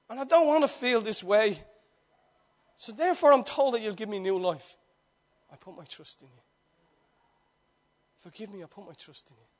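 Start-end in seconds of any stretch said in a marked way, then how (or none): 5.5-9.5 quiet prayer. Retold or imitated. Retold prayer.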